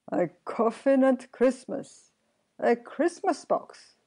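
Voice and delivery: high, loud delight at a grim joke; gleeful crowing. A narrator's reading. Sexton alone on the tombstone, gleeful crowing. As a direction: crow it out to yourself.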